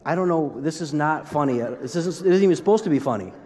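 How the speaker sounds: ordinary talk, nothing marked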